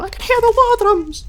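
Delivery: shrieking high dramatic voice; high theatrical cry. Acted. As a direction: wail it like a doomed prophet. Hammy narrating character cry.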